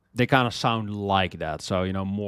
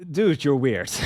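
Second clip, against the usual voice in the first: mock reply; 5 semitones higher, 7 dB louder than usual. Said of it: "and I'm like".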